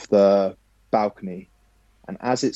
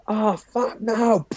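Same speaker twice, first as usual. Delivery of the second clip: much higher comic cry; sketch-show yelp; 12 semitones higher, much slower than usual. Retold or imitated. Fitness coach crashing his bike, much higher comic yelp. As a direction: squeal it like a sketch-show character. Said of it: "Some noise from a sketch show".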